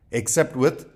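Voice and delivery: ordinary delivery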